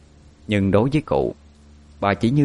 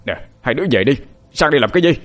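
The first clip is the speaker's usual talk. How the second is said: put-on higher, louder yell sending the children away; desperate shout; 5 semitones higher, 4 dB louder, much faster than usual. A narrator's reading. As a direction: shout them off in alarm. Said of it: "ông Cố gào lên".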